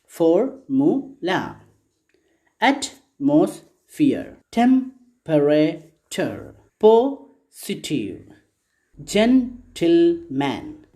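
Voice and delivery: "Formula, Atmosphere, Temperature, Positive, Gentleman" broken slowly into separate syllables; "Formula, Atmosphere, Temperature, Positive, Gentleman" in slow beats, first syllable weighted; slow syllable-by-syllable model. Shown as a demonstration. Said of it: "listen and repeat".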